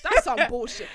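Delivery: high, loud exasperated rant; indignant. Real, not acted. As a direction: protest loudly in disbelief. Outraged nobody took her out.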